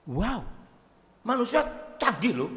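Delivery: ordinary talk, nothing marked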